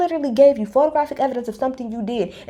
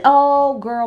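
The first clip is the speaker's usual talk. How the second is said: ordinary delivery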